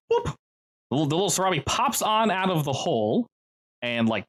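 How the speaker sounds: usual speaking voice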